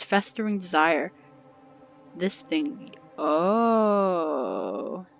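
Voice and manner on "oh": drawn-out realization. Real, not acted. Realises while reading event screen.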